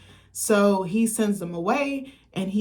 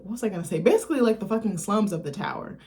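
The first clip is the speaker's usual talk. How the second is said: ordinary delivery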